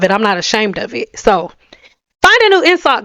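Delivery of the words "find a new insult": defiant retort; defiant jab. Real, not acted. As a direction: throw it back at the haters.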